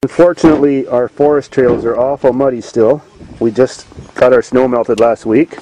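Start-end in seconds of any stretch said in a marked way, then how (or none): none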